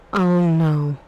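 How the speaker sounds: loud, drawn-out horrified moan; long moan of dread; moan of horror. A narrator's reading. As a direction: moan it out loud, stretching the words. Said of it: "in a moan of horror".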